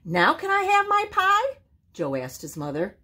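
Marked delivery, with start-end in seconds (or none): 0.0-1.6 put-on very high child-like plea; little possum voice. A narrator's reading. Joe asking his mother for pie, high little voice. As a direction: ask it high and eager.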